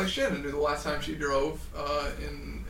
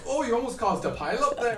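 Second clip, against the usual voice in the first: lightly higher-pitched Irish officer; Irish accent; 6 semitones higher than usual. Retold or imitated. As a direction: imitate a cop's Irish accent. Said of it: "In his Irish accent".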